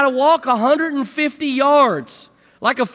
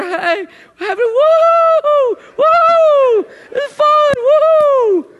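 whooping, much higher and louder; big fake party whoops; mock cheering; 14 semitones higher, 7 dB louder, much slower than usual. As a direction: ham up a joyful woo to be heard far off. Mock cheering to far-off people.

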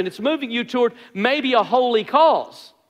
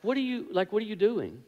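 much softer, asking himself inwardly; quiet self-rebuke; 9 dB quieter than usual. Retold or imitated. Catching himself after the outburst, quietly self-questioning. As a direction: hush it like a conscience speaking.

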